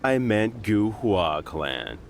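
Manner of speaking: slow, sneering fake correction; mock apology. Thug taunting the Guhua youth, slow mocking sneer. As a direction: drag it out, pretending innocence.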